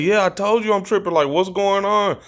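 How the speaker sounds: high-pitched retold outburst; worked-up protest. Himself answering friends who laugh at him, worked-up protest.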